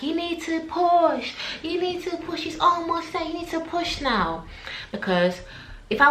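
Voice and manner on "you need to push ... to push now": urging staff. Retold or imitated. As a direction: coax her to push, insistent. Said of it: "They're like".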